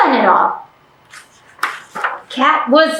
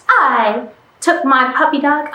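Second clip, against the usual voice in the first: ordinary delivery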